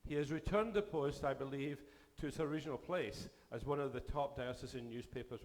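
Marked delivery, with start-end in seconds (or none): none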